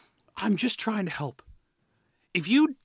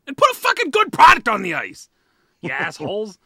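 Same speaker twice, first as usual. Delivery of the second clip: loud, exasperated demand, half laughing; fed-up shout; 8 dB louder than usual. Real, not acted. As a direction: yell it at the owners, then laugh. Rant climax at front office.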